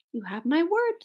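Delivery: soft, high mouse voice; high little voice, softly; promising mouse. A narrator's reading. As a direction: give a small, polite promise. Mouse's solemn promise to bear.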